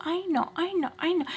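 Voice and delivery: high, dismissive mock of her brush-off; a mocking high-pitched echo of the mom's brush-off; mocking I know. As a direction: toss it off like a sarcastic parrot. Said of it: "I hate the whole".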